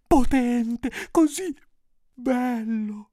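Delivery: high, slow, drawn-out adulation; hammed-up princess fawning; flattering princess. A narrator's reading. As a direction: flatter the ogre, stretching each compliment. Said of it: "provava pure ad adularlo".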